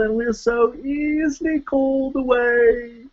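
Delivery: slow, high mock-English recital; song voice impression. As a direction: imitate the odd spoken voice from the song. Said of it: "he's like".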